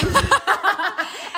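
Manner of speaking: a high, loud burst of laughter; sudden high laugh. Real, not acted. Laughs at friend's answer.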